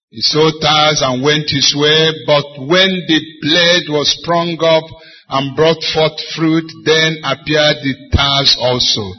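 loud, slow chanted Bible reading; preacher reading Matthew to the congregation; scripture reading. Acted. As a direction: intone the verse to the church. Reads scripture aloud in preaching.

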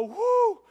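high, loud "whoo"; retold whoop of relief; relieved whoop. Driver finds everyone okay, high relieved whoop.

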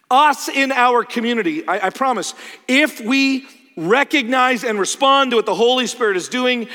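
loud, high, insistent preaching; forceful preaching. Real, not acted. Preacher promises his church God will work, forceful preaching. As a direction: drive the promise home to the congregation.

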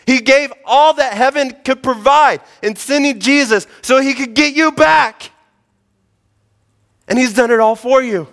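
high, loud full-force sermon delivery; fervent preaching. Acted. Pastor preaching his congregation toward Jesus, fervent and loud. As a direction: preach it out, driving every line home.

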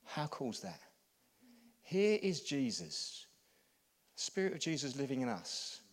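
ordinary talk, nothing marked

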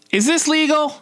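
very high-pitched, incredulous question; alarmed disbelief. Retold or imitated. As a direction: squeak it out in mock alarm. Retells alarmed question about electric shocks.

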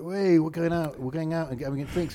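high, loud, breezy going-out call; rally the mates for drinks, oblivious; unsympathetic friends. Retold or imitated. Mates off out drinking, breezy and unsympathetic. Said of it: "we were so unsympathetic".

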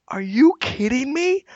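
high disbelieving cry; incredulous. Retold or imitated. Retelling PR blunder, high incredulous disbelief.

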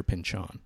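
Pynchon said as pin-CHON; Simpsons pronunciation. Shown as a demonstration. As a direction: say Pynchon with a clear, open "chon" at the end. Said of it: "they call him Mister".

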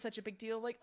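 normal talking voice

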